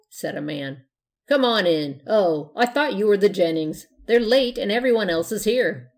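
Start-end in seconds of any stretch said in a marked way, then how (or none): 1.3-5.9 loud host. A narrator's reading. Host welcomes at party.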